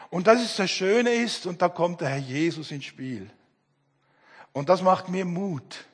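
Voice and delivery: normal talking voice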